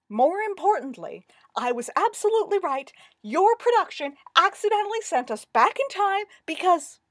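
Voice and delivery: high, loud, emphatic argument; indignant insistence. A narrator's reading.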